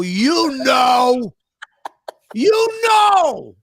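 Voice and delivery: loud, high, exasperated shout; furious shout. Retold or imitated. Furious retort to wife.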